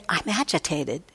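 soft, quiet, even I'm agitated; simply note the feeling gently; calm noting. Shown as a demonstration. Teacher showing a mindful noting of agitation, soft and calm. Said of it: "We can say".

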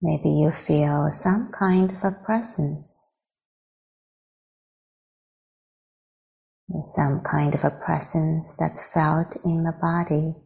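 guided meditation. Real, not acted. Teacher leading friends to arrive in the body, guided meditation. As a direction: guide them unhurriedly into stillness.

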